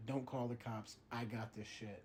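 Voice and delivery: low, hushed, cool-headed; quietly taking charge of the case; detective's calm line. Retold or imitated. Detective to the host, low and calm. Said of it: "He's like".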